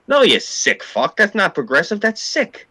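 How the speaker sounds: indignant rebuke, voice rising. Real, not acted. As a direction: scold them in disbelief, pitch climbing. Outrage at those he condemns.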